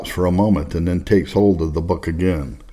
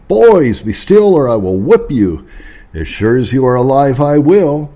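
loud, raised irritated threat; irritated exclamation; 6 semitones higher, 6 dB louder than usual. A narrator's reading. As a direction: burst out high and loud, irritated father warning rowdy boys. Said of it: "in an irritated voice, he exclaimed".

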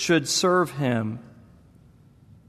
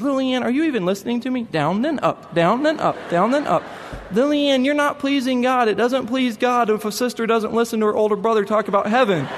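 put-on higher, fast indignant child scolding; a small boy fed up with his sister; exasperated little boy; 8 semitones higher than usual.

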